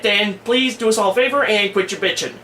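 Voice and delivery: usual speaking voice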